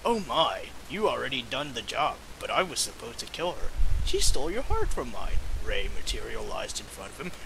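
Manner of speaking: quiet, fast read-aloud narration; story narration. A narrator's reading. Returning to the story, he reads it quietly and fast. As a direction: murmur the story quickly, low and soft.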